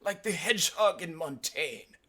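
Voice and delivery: high put-on professor's voice; mock-scholarly comeback; smiling retort. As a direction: deliver the taunt high, with a smile.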